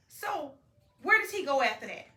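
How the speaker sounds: ordinary delivery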